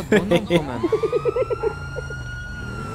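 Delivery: high cackle. Acted.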